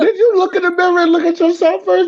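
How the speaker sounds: squeaky high mock of a scolding man. Friend mocks the scolding man in a squeaky high voice. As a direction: pitch way up to mock him. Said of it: "He was like".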